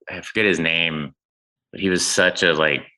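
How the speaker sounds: usual speaking voice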